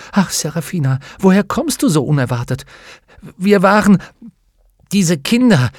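high-pitched, breathless-to-tell outpouring; agitated relief. Acted. Lyra reunited with the witch after danger, agitated and overjoyed. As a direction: pour it out in a high, emotional rush of words.

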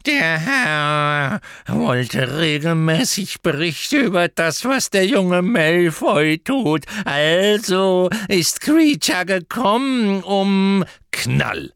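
house-elf Kreacher reporting to his master; grumbling house-elf. A narrator's reading. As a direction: croak it like a servile old elf. Kreacher voiced as creature.

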